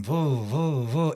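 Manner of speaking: repeated buzzing phone noise; voiced vibrating-phone sound; imitated phone buzzing. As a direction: mimic a phone going off nonstop. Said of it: "it was like my phone were going".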